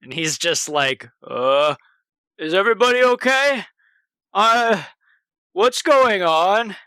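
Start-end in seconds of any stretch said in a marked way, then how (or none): 1.3-6.8 a lightly voiced, higher, nervous big lug; childlike scared voice. Wesley asks the crew what happened, lightly voiced and nervous. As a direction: sound like a frightened overgrown kid. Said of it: "like a child, curious, unsure, terrified".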